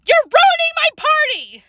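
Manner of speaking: loud, very high-pitched mad yell; furious yell. A narrator's reading. Host girl mad at friends wrecking her party, furious yell. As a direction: scream it out, at your wits' end. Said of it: "I got so mad I yelled".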